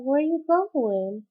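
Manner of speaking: high, loud, drawn-out question; retold dismayed question. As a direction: call it after someone who is leaving. Acts out alarm as mother leaves.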